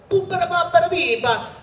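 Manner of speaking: high, loud cartoonish mock Italian babble; bad Italian accent. Voice actor imitating Peter's bad Italian accent. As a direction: ham up a goofy fake Italian gibberish. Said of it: "this really bad Italian accent where he goes".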